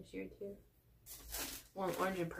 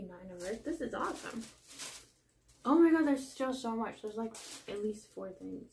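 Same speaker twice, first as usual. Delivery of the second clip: ordinary talk, nothing marked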